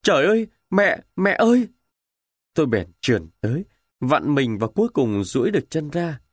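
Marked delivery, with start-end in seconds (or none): 0.0-1.7 high, loud cries of a wounded man; wounded soldier calling for his mother; anguished cry. Acted. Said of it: "tôi nghe sát bên tai tôi có tiếng kêu".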